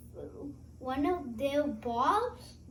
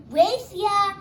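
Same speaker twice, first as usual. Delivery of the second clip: playful challenge; 7 semitones higher, 12 dB louder than usual. Acted. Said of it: "Stripes said".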